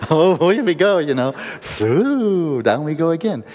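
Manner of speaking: high, loud hammed-up whoop; playful mock excitement. Relaxer heading down for another round, playful mock excitement. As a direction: whoop it like setting off on a ride. Playing the relaxer setting off again.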